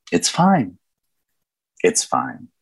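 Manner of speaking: self-soothing, the repeat dropping low; steady yourself after a few tears; acted brittle reassurance, trailing down; composing himself.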